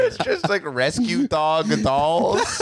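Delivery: slow, high-pitched giggly delivery; laughing. Real, not acted. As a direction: giggle out rescue dog dolls, very slow. Joking about the cart items.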